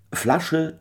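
high glad call to the bottle; joyful cry. A narrator's reading. Michael cries out to his bottle in joy. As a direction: summon the bottle with glee. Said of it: "schrie in seiner Freude".

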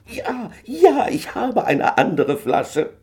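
high, loud overjoyed shout, slowed; exclaiming joyfully. A narrator's reading. Michael shouts his news to Mary, overjoyed. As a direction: burst out with triumphant news. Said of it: "rief er aus".

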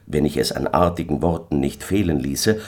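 ordinary delivery

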